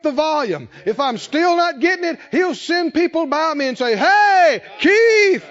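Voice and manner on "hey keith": high, loud call across the room. Retold or imitated. Messenger sent by God loudly calling Keith by name. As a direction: hail him loudly by name.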